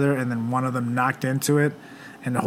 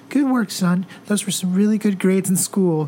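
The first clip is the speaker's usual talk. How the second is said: higher, sweet praising parent; good cop parent; 7 semitones higher than usual. Pretend. Mom praising the kid's grades, lightly sweet. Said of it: "be like".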